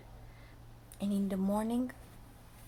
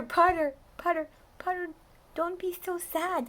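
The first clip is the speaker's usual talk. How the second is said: coaxing call; 10 semitones higher, 5 dB louder than usual. Real, not acted. Comforting a child listener.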